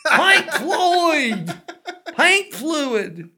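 high, loud mock yell through laughter; laughing shout. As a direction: yell it out while cracking up. Shouting both versions, laughing.

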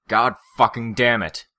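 loud, high, furious shout. Acted. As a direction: explode with rage. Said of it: "Brian was shouting".

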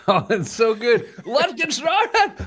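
high, drawn-out squeal through laughter; laughing delight. Real, not acted. Host opens the show with his drink, laughing squeal. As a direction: break into high giggles as you say it.

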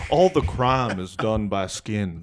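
low, easygoing Texas mumble-mouthed twang; Texas accent. Acted. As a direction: do a cartoonish Texan, voice dropped low. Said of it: "now you're just doing Mike Judge".

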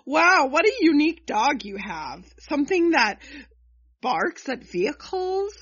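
loud, high sarcastic awe; mock amazement. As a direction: marvel with exaggerated, disbelieving enthusiasm. Mocks the dull reader story.

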